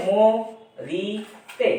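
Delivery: "ম, রি, তে" split into separate beats; syllable by syllable. Shown as a demonstration. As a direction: pause between each syllable of "ম, রি, তে". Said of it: "এগুলো মুক্তাক্ষর".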